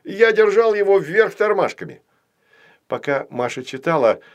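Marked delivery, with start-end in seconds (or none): 0.0-2.0 high, loud burst of discovery; sudden realisation. A narrator's reading. Puppet finds he held the letter upside down, excitedly realising. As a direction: announce the silly mistake triumphantly. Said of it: "догадался кукла Ваня".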